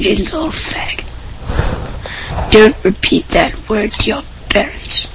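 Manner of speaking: quick sneering whisper. Real, not acted. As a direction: whisper the insult fast. Mean aside, then warns listeners.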